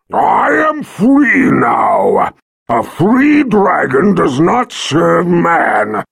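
high, loud, slow defiant roar; proud dragon shout. Acted. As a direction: boom it out with pride. Dragon voice held.

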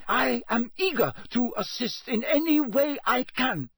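high, eager, ingratiating character voice; unctuous. A narrator's reading. Karkaroff offering the Ministry his help, unctuous. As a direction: plead to be useful in an oily, high-pitched voice. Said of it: "unctuous note in it".

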